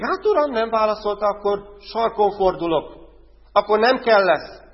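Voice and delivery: lightly voiced proud sulk; imitating a prideful heart; mock arrogance. Voices a proud believer's reply.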